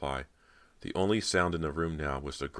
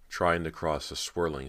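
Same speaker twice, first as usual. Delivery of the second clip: ordinary delivery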